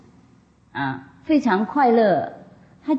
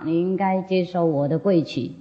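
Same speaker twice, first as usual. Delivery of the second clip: ordinary talk, nothing marked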